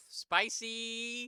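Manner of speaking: high, loud, delighted cry of "Spicy!"; excited exclaim. Acted. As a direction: shout "Spicy!" up high with glee. Reacting to a spicy drawing.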